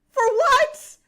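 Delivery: high, incredulous outburst; exasperated disbelief. Real, not acted. Host asking why strangers make edits of her, high exasperation. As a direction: voice shooting up in bafflement.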